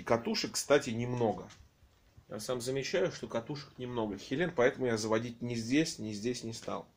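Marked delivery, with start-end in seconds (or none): none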